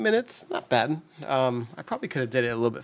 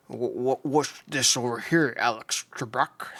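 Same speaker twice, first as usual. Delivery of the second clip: a lightly Scottish Sean Connery teasing the quiz host; mock Sean Connery, a little stammer up front; bad Sean Connery impression. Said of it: "that's a horrible impression".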